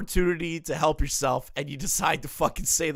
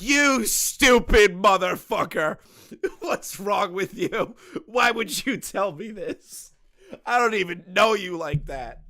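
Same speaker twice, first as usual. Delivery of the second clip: slow, louder mock rant through laughter; half-laughing furious scolding; imitated angry tirade, breaking into laughs; mock screaming; 4 semitones higher, 5 dB louder than usual. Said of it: "I'd be screaming at him at full volume".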